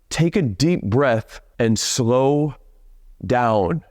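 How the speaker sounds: slow and unhurried, modelling calm; slowed coaching delivery; slow, drawn out. Shown as a demonstration. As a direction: let it stretch slowly.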